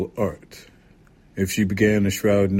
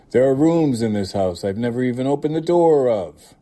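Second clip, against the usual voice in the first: higher, louder aunt's complaint; indignant, raised storybook voice; indignant protest; 5 semitones higher, 5 dB louder than usual. A narrator's reading. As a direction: protest with swooping emphasis. Testy dying aunt protests.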